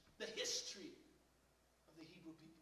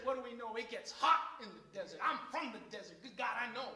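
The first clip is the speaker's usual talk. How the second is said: loud, rising, shouting preacher; full-force sermon climax; fiery preaching; 10 dB louder than usual. Real, not acted. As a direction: preach it out, building up.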